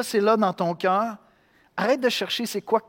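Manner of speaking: ordinary delivery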